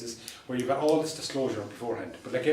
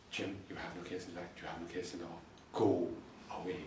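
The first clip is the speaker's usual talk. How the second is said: quiet refusal; 6 semitones lower, 8 dB quieter than usual. Retold or imitated. Calm refusal to the barrister.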